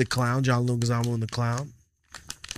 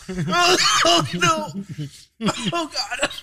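laughing; 13 semitones higher, much slower than usual. Real, not acted. After choking on a gummy.

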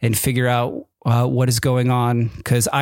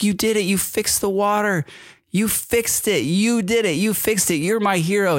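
high, delighted praise; excited cheering; 8 semitones higher than usual. Real, not acted. Father cheering his son for fixing the water, high and excited. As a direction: cheer him on like he saved the day.

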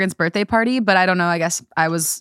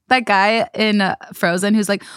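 normal talking voice

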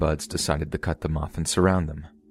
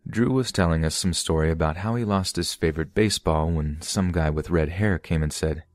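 usual speaking voice

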